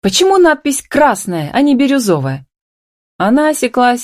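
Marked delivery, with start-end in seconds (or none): 0.0-2.5 high, agitated woman's complaint; exclaiming in dismay. A narrator's reading. Upset wife confronting Миша over the sign, high-pitched exclamation. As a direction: demand an explanation, pitched high. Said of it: "вскрикнула она".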